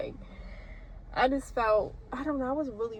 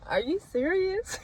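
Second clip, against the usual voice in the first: incredulous; 9 semitones higher, slower than usual. Retold or imitated. Disbelief at failing the test.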